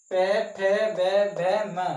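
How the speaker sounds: पे, फे, बे, भे, म called out loudly, each letter separate; loud classroom recitation of the letters; loud consonant drill. Shown as a demonstration. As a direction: hammer out the lip row loudly. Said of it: "इसको उच्चारण कीजिए।".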